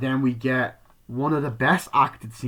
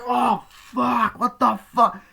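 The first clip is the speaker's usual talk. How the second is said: high, loud cry of disbelief; acted-out high-pitched freakout; shocked yelling; 13 semitones higher, 8 dB louder, much slower than usual. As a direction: yell it in horrified shock. Acting out shocked viewer at gore.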